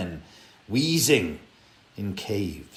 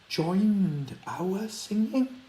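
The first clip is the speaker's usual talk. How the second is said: eerie; 10 semitones higher, much slower than usual. A narrator's reading. Eerie voice joins in.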